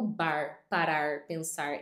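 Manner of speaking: bar, parar, pensar with a pulled R at the end; Portuguese words ending in a drawn R; Brazilian final R. Shown as a demonstration. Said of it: "Eu puxo o R no final".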